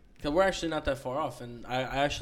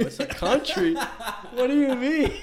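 much higher and louder, laughing disbelief, slow at the end; shocked kid; 11 semitones higher, 7 dB louder, slower than usual. Retold or imitated. Kid learns from grandma that I T is a country, high laughing disbelief. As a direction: squeak it out in baffled laughter. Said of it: "I go".

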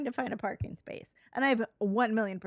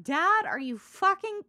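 higher, louder, slow mock exasperation; Jesus; 8 semitones higher, 6 dB louder, slower than usual. Son confronts his dad, mock exasperated. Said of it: "that's Jesus being like".

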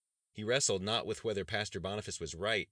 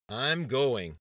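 loud, higher I'm going, falling hard; loud insistence; 7 semitones higher, 10 dB louder than usual. Acted. Governor silences dissent with sheer volume. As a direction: drown out the dissent. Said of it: "insisted in a loud voice".

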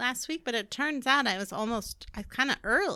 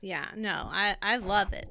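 ordinary talk

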